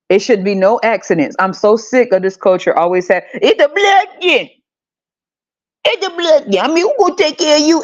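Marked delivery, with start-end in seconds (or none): 3.3-7.8 much higher, quick put-on voice of a nagging elder; mock the family guilt-trip in a pitched-up voice; hammed-up high imitation of an older relative. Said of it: "everybody ends up talking just like this".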